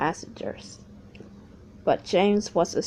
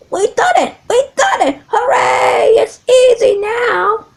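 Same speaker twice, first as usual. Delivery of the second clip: very high, loud whooping; triumphant cheer; 13 semitones higher, 13 dB louder than usual. Acted. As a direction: shout with joy. James cheering at the top.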